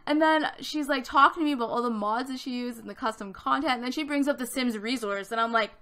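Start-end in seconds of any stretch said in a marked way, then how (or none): none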